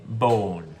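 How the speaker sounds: usual speaking voice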